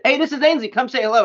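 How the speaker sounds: high, loud cheerful call to strangers. Retold or imitated. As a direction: call people over across the store. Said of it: "he's just yelling at people".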